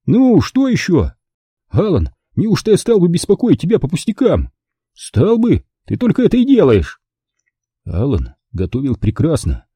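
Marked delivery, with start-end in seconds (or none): 0.1-1.1 high, slow exasperated question. A narrator's reading. Interrupted cook snaps back. 1.7-4.5 high-pitched mild indignation; indignant. A narrator's reading. Friends bickering. 4.9-7.0 high-pitched bickering comeback; peevish retort. A narrator's reading. Friends bickering.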